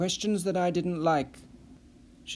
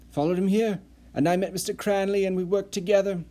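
higher, breathless gushing voice; eager Nissen; 5 semitones higher than usual. Acted. Nissen gushing relief to the rescued Perry, higher voice. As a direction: pour it all out in a rush of relief.

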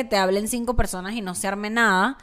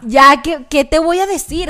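louder, exasperated, out of ideas; exasperated sexting partner; 5 semitones higher, 7 dB louder than usual. Retold or imitated. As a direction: throw up your hands, nothing left to say. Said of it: "como que, marico".

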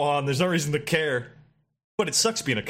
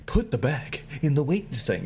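calm robot woman voice; slow, calm robot voice. Retold or imitated. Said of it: "this robot woman voice is just like".

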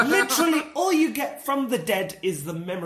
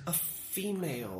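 put-on medium; 3 semitones lower, 12 dB quieter than usual. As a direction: feel your way softly into the message. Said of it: "mediums will go like".

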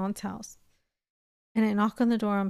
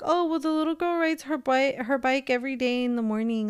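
higher-pitched lightly voiced neighbor lady; chatty mock neighbor giving directions; imitating neighbor lady; 5 semitones higher than usual. Woman voicing neighbor's helpful answer.

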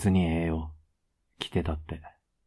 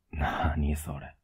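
slow soft tender murmur; 2 semitones lower, 4 dB quieter, slower than usual. Acted. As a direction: melt at her words. Touched by girlfriend's confession.

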